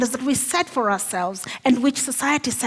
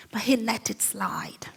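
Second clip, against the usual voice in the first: hushed whisper, almost no voice; 3 semitones lower, 8 dB quieter than usual. Shown as a demonstration. Preacher whispers the repeated line to the congregation. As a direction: drop to a breathy whisper for weight.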